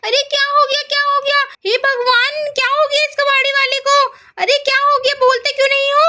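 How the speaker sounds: very high-pitched anxious questioning; acted alarm. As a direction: fret urgently over someone hurt. शोर सुनकर घबराए लोग आए. (घबराहट भरी ऊँची आवाज़)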